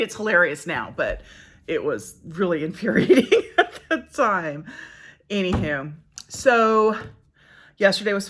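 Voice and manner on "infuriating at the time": laughing. Real, not acted. Laughing about infuriating memory.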